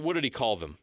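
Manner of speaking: ordinary talk, nothing marked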